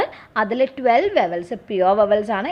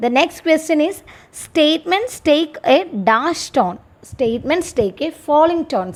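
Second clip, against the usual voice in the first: slow, measured reading of an exam question for students; slow dictation reading; 6 semitones higher, much slower than usual. Acted. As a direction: read it out slowly, word by word, like dictating to a class.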